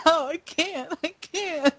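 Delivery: high, softening can't, pitch way up; mock tearful whimper. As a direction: play at being too upset to watch. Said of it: "and I'm just like".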